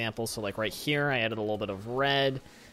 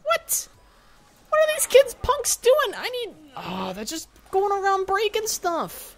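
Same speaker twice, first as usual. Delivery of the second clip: loud, squealing indignation; exasperated yelling way up high; high-pitched outrage; 7 dB louder, slower than usual. Real, not acted. Player outraged at kids vandalizing park.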